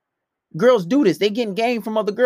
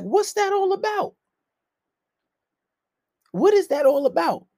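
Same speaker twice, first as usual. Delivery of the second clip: high-pitched incredulous questioning; mock bafflement; 11 semitones higher than usual. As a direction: pitch it way up, playing puzzled. Mock questioning of a cheating partner.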